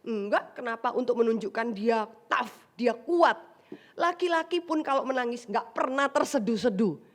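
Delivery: ordinary talk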